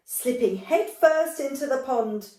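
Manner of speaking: usual speaking voice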